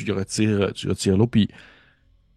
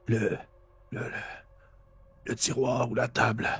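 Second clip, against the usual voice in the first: parched, croaking whisper; raspy murmur; 3 semitones higher, 6 dB quieter than usual. Acted. Freed captive who has not drunk for hours, raspy murmur. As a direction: rasp out the words haltingly through a dry throat. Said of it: "il murmure dans un espèce de racle".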